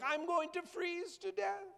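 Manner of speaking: very high squeaky bird voice; squeaky high bird despair; little bird voice. Acted. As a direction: whimper it like a tiny doomed bird. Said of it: "the little birdie's like".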